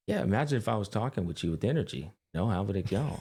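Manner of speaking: quick, energy-drained flat delivery; monotone demo. Shown as a demonstration. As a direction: drop all the energy and keep one pitch. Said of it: "Imagine if I was just like".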